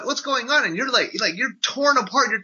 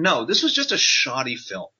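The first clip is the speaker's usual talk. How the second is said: usual speaking voice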